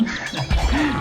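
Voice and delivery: short amused laugh; a quick laugh at yourself; light chuckle. Real, not acted. Laughs at own remark.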